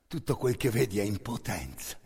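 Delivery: normal talking voice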